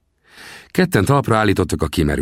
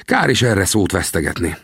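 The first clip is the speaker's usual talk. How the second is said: ordinary delivery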